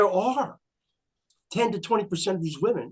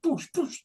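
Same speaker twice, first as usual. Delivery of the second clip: slap sound effect; 11 semitones higher, 4 dB quieter than usual. Retold or imitated. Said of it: "like that High Karate commercial".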